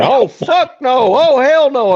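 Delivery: high, slow yelled panic; panicked yell. Retold or imitated. Cody yelling in horror as Carrie chases him. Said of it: "all of a sudden I hear".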